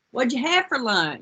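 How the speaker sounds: put-on change the subject in a playful Southern accent. Teacher dodging pupils' question, slow mock Southern drawl. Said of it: "I'd be talking in a Southern accent".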